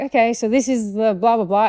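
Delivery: imitated owner making introductions; retold owner chatting. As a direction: lightly voice a dog owner's patter. Retold owner introducing her dog.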